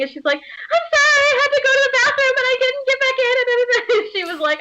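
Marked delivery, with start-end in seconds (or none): 0.4-3.3 very high, rushed cartoon-girl wail; frantic mock-crying heroine; hammed-up tearful apology. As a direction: blurt excuses in a squealing panic. Heroine's flustered apology when found.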